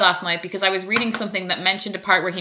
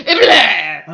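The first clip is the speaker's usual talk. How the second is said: louder, hammed-up growl of a villain; mock evil Tennant; 5 semitones lower, 6 dB louder than usual. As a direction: send up a cartoonish bad guy. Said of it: "And he was all like".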